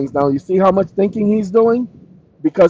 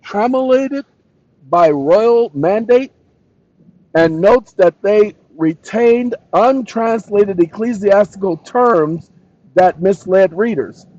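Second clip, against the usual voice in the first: raised, slow, deliberate reading; emphatic reading aloud; 5 semitones higher, slower than usual. Real, not acted. Streamer reading out the accusation, emphatic reading. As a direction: read it out slowly, lifting each weighty term. Reads the chatbot's answer.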